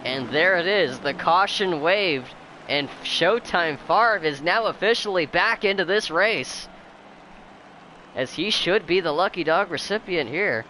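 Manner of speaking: upbeat race caller energy; excited play-by-play. Real, not acted. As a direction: call the action, lively and bright. Race call during caution.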